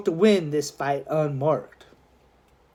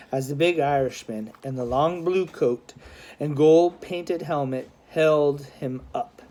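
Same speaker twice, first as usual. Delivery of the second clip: usual speaking voice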